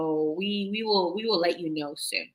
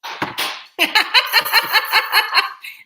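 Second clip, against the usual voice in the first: high laugh; 13 semitones higher, 6 dB louder than usual. Real, not acted. Laughs at partner's joke.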